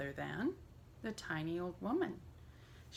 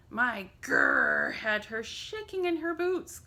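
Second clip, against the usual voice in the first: growling; 4 semitones higher, 6 dB louder than usual. A narrator's reading. A touch of growl as the bear brags. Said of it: "growled the bear".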